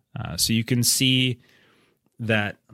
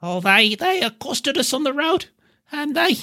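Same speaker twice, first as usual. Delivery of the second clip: high, upset hobbit voice, much higher; distressed hobbit; 3 dB louder than usual. Acted. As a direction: pour out the bad news. Hobbit tells of the brigands.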